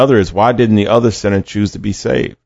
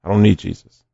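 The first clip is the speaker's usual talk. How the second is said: quiet, offhand brush-off; dismissive shrug-off; 5 dB quieter than usual. Retold or imitated. A sinner on the cross quietly brushing off Jesus, dismissive. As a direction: wave it away lightly. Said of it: "or the sinner that says".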